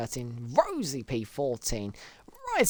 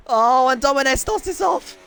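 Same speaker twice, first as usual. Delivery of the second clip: louder and higher, thrilled call; excited announcement; 9 semitones higher, 6 dB louder than usual. Real, not acted. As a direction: announce the start like big news. Announcing the race start, excited.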